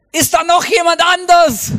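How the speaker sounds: high, loud desperate shout. Acted. Climber rejecting God's answer, high panicked shout. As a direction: yell it like a last hope. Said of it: "plötzlich schreit er".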